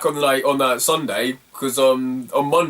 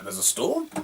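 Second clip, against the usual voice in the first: surprised; 11 semitones higher, 7 dB quieter than usual. Retold or imitated. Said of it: "I'm like".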